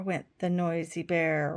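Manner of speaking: lively picture-book reading with voices for the animals; reading to children. A narrator's reading. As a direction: read it like a bedtime story for little ones.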